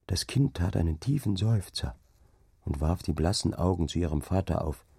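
ordinary delivery

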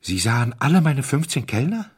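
high, loud, incredulous question; stunned. A narrator's reading. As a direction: blurt it out in disbelief. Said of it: "stotterte er". (fassungslos fragend)

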